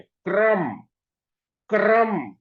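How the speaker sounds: क्रम with k and r spoken together; क्रम said crisply, kr fused; demonstrating क्रम with a tight kr cluster; say kram. Shown as a demonstration. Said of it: "साथ साथ बोल रहे हैं".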